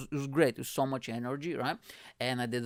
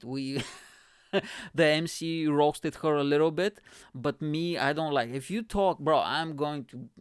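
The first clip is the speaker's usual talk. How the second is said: ordinary talk, nothing marked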